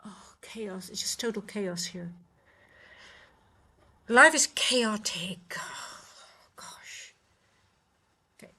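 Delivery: exasperated whisper; whispered aside. Real, not acted. Streamer whispering to viewers about the chaos at home. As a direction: breathe it out as a weary aside.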